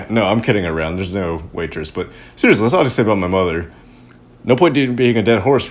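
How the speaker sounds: ordinary talk, nothing marked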